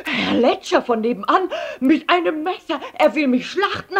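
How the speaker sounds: hoch, laut und außer Atem; atemlos panisch. A narrator's reading. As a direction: gehetzt Alarm schlagen. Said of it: "er jappte nach Luft". (panisch japsend)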